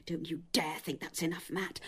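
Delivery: soft, low, controlled anger; quiet warning. Acted. Lillian won't accept Matt's explanation, quietly furious warning. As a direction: drop the volume and warn him off. Warns partner in quarrel.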